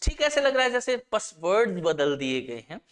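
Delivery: ordinary talk, nothing marked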